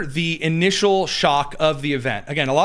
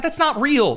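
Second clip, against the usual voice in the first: high-pitched "That's not real" with stress on "not"; exasperated protest; 10 semitones higher than usual. Real, not acted. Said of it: "Like, you don't stop".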